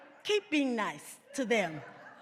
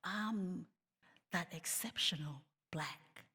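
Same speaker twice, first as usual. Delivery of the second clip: whispered, much softer and lower; hushed whisper; 6 semitones lower, 10 dB quieter than usual. Retold or imitated. Someone tempted to accept the flattery, whispering softly. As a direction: breathe it out like a guilty confession. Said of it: "for people then to say".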